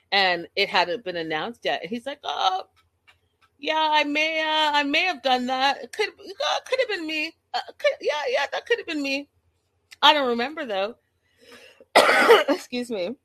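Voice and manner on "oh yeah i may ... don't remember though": high, hesitant imitation of a guilty man; hammed-up, stumbling half-confession; mock sheepish admission. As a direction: stall and hedge in a high put-on voice. Acted sheepish drunk admission.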